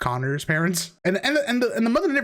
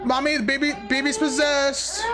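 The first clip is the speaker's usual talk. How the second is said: high, frantic, scared voice; frantic panic; 11 semitones higher than usual. Acted. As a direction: panic about a possessed baby. Acting scared parent.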